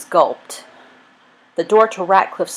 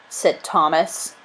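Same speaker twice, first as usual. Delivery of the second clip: ordinary delivery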